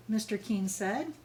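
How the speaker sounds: ordinary delivery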